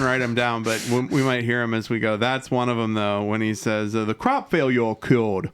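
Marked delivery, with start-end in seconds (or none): 4.0-5.5 put-on odd Southern baby voice on "The crop failure killed"; a hammed-up Southern accent, lightly voiced; mock Southern baby. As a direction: imitate a bonkers Southern line reading. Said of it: "he sounds like a Southern baby".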